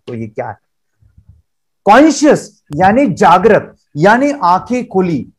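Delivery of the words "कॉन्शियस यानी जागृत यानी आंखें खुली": slow, deliberate teacher explanation; slow explaining. Shown as a demonstration. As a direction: spell it out slowly for the class. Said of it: "थोड़ा सा simple भाषा में बोलता हूं". (धीमे, समझाते हुए शिक्षक का लहजा)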